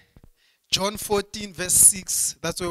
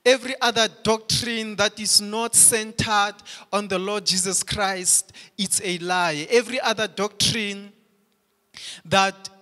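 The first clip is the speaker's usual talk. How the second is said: raised, driving pulpit delivery; fervent preaching; 3 semitones higher, 3 dB louder than usual. Acted. Pastor to congregation on exclusive truth: fervent preaching. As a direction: preach it out with mounting fire.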